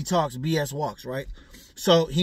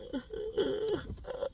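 sudden high-pitched giggle; a quick squeaky laugh at the thought; 11 dB quieter than usual. Real, not acted. Laughs at questionable poses.

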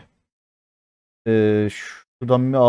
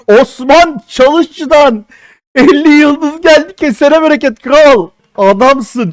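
high, loud, slow shout-out to a donor; excited thanks; 12 semitones higher, 6 dB louder, slower than usual. Real, not acted. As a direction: hype up the donor. Thanking donor for fifty stars.

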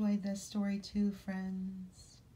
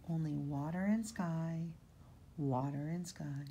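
put-on reading to children; 2 semitones lower than usual. A narrator's reading. Reading picture book aloud.

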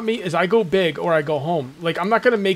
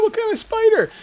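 much higher put-on voice; mock wife asking. Wife asking the husband what spider, in a much higher put-on voice. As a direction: ask it up high and wary.

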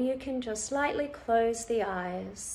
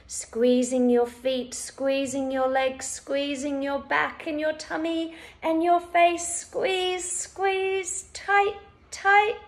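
building from raised to high-pitched urging; urging to squeeze; 5 semitones higher, 5 dB louder than usual. Shown as a demonstration. Instructor building a whole-body squeeze, urging to squeeze. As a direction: climb steadily as the whole body tightens.

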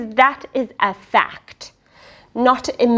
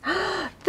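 amazed gasp; 11 semitones higher, 12 dB quieter than usual. Real, not acted.

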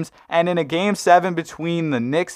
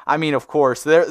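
ordinary delivery

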